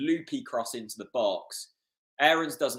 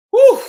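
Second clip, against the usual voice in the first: loud whew; 17 dB louder than usual. Real, not acted. Fan exhales after the chaos.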